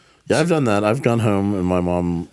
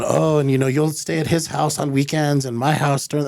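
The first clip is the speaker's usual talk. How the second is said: higher-pitched mock mom voice; lightly voiced mom, pitched up; imitated chattering mom; 8 semitones higher than usual. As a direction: prattle on like an oblivious mother. Acted mom explaining divorce arrangements.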